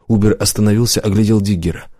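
usual speaking voice

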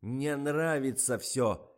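loud, drawn-out bellow; raging boss's bellow; angry roar. A narrator's reading. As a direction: roar slowly, then yell the name high. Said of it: "заревел Николай".

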